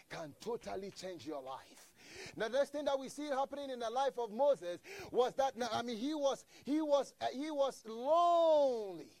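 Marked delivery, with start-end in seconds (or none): 2.3-9.1 higher, louder, swelling sermon climax; fervent preacher lifting the congregation; preaching at full force. Acted. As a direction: build it up like a revival preacher. Preaching builds again.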